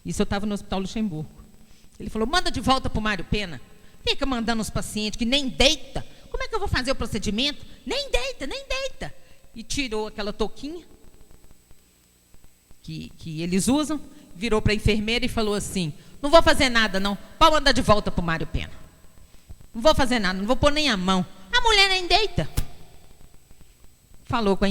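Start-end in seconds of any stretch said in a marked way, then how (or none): 2.3-9.1 mock outrage climbing to a very high pitch; acted fuming, higher and higher; irritated doctor. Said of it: "esse médico ficou muito nervoso". 16.2-22.5 loud, imitated refusal with a high final outburst; acted exasperation, louder and jumping up in pitch; irritated doctor. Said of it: "esse médico ficou muito nervoso".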